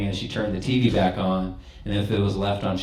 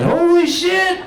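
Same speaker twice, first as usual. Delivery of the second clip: high, loud shocked exclaim; mock-stunned holler; astonished yell; 6 dB louder, slower than usual. Acted astonishment at punchline.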